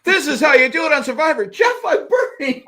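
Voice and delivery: high, strained cry of pain; burning contestant. Pretend. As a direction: yelp like feet are on fire. Acting contestant on hot firewalk.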